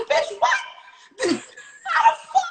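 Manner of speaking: shrieking high and loud in disbelief, breaking into a laugh; a stunned squeal of outrage, laughing; high incredulous shriek dissolving into laughter; shocked disbelief. Retold or imitated. Hearing they're covid negative.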